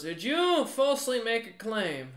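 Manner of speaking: challenging guard captain. Acted. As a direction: confront the noble as guard captain. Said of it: "looks up at the noble and goes".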